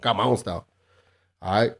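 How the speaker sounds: usual speaking voice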